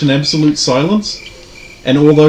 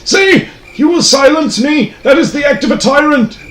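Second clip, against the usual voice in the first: hammy character voice; 12 semitones higher than usual. Acted. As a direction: defy them like a pious martyr. Priest NPC defying the players.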